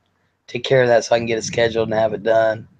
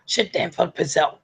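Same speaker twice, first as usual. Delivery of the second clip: rattled-off cursing; 5 semitones higher than usual. Shown as a demonstration. [name] showing Sarah how to cuss, rattled off. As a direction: swear words run together, higher pitched. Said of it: "You're supposed to go".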